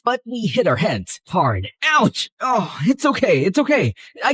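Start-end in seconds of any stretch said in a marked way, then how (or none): 1.9-2.3 pained yelp. Acted. Hit heads hard.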